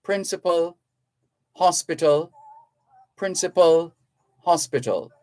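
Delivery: "principal" and "hospital" said with matching endings; rhyming demonstration. Shown as a demonstration. Host drills pronunciation, "principal" matched to "hospital". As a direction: chant the two words apart so "principal" echoes "hospital". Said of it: "That's the pronunciation".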